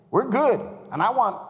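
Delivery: high, loud burst of preaching; preaching climax. Acted. Preacher peaking while urging the congregation. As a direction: lift it into a near shout.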